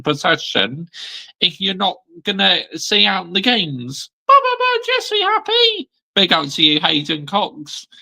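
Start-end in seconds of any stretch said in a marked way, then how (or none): 4.3-5.9 much higher, mocking heckler voice; a shrill-pitched taunting fan; squeaky heckler. Rival fan taunting Joshy J, high squeaky mock voice.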